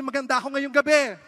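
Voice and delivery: high shouted line for the crowd; loud crowd prompt. Pretend. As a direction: shout it like the audience would.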